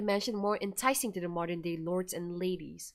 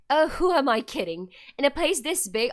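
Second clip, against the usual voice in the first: ordinary delivery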